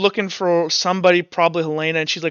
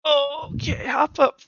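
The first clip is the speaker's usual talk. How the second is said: high sing-song coaxing, much higher; coaxing dog voice; 9 semitones higher, slower than usual. Real, not acted. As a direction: coax the dog up gently. Coaxing dog onto lap.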